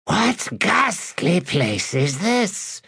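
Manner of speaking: slow, high hissing voice. A narrator's reading. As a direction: hiss it out slowly, high. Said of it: "hissed a new voice".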